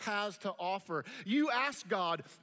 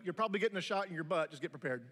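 fast deadpan warning; 2 semitones lower, much faster than usual. Retold or imitated. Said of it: "I would just tell them".

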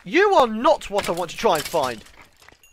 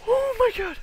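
shocked; 14 semitones higher than usual. Real, not acted. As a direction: react stunned, pitched way up. Shock after creeper blast.

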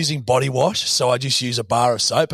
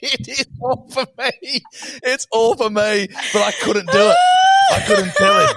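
higher, laughing loud admission; laughing confession; 8 semitones higher, 3 dB louder than usual. Real, not acted. Telling his friend the bath stuff was his, laughing loudly. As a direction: crack up at your own lie.

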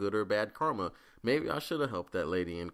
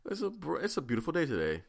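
mock sweet politeness; 4 semitones higher than usual. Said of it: "be like".

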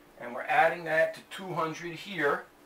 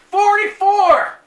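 a high, loud shouted number; yelled number; 14 dB louder, slower than usual. Retold or imitated. New inmate yells a joke number to cellmates, loud shout. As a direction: bellow it across the cell block. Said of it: "So I yelled out".

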